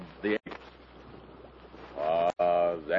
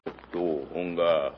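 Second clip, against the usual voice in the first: guttural; 4 semitones lower, 6 dB quieter than usual. Acted. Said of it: "the leader speaks gutturally".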